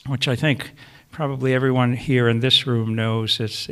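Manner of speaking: usual speaking voice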